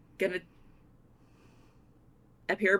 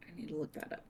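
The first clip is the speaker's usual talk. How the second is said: whispering; 3 semitones lower, 18 dB quieter, faster than usual. Real, not acted. Muttered aside to herself.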